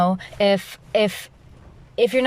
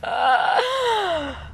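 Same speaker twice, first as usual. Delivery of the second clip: high laugh; 9 semitones higher than usual. Real, not acted. Laughing after the joke.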